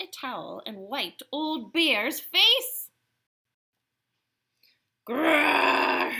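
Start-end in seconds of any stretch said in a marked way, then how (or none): 5.1-6.1 long, loud, higher growling roar; bear growl. Acted. Old Bear protests having his face wiped, growling.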